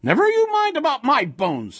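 put-on high, loud scolding old woman; fearless, affronted old wife; indignant cry. A narrator's reading. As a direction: snap back at the monster, pitch way up. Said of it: "the old woman cried".

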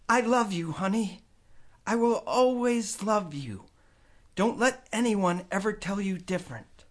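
tender mother. A narrator's reading. Mom's goodbye to Kenny.

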